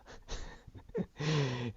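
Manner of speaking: short soft laugh at himself; self-laugh. Real, not acted. Tutor laughs at himself before viewers. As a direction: chuckle at your own lack of prep. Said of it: "I sit here and laugh at myself".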